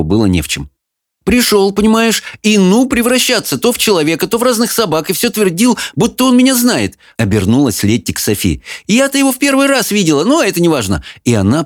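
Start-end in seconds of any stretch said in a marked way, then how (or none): 1.3-7.0 put-on high, chatty young woman; lively young girl. Lettie voiced by narrator. 8.9-11.0 put-on high, chatty young woman; lively young girl. Lettie continues.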